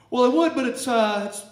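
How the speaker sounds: loud, high, stumbling excuse; flustered reply. Retold or imitated. Angler explaining lost pole to buddy, flustered. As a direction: blurt it high and loud, then falter.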